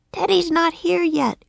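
put-on high little-girl voice, croaked; a girl turned raven, croaking. Annie to Jack in the nursery, high croaking girl. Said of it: "croaked Annie".

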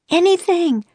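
very high call out. A narrator's reading. Annie calling out to Jack, very high. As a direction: call it across the cloister. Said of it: "she called".

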